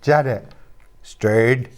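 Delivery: put-on Stern in a Northern Irish accent, the vowel rounded like "stoorn"; playful Northern Irish Stern, the second one almost breathed. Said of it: "have to say it in a Northern Irish accent".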